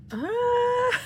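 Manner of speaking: high, loud squeal; excited squeal. Real, not acted. Fan spotting her favourite member squeals. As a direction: squeal at the sight of him.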